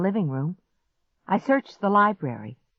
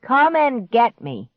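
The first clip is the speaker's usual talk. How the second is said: slow, raised, loud dare; taunting call; 7 semitones higher, 6 dB louder, slower than usual. A narrator's reading. Boy dares the monster, calling out slowly. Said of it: "he called out".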